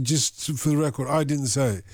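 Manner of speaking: ordinary talk, nothing marked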